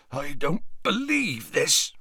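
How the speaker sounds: slow, hoarse disbelief; a big man rasping in shock; hoarse Hagrid. A narrator's reading. Hagrid stares down at hurt Ron, hoarse and slow. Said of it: "said Hagrid hoarsely".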